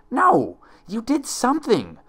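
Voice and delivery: high, loud protest; shouting. Acted. Twilight shouting at her impostor, high and loud. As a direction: hurl the accusation back at her. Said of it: "Twilight shouted".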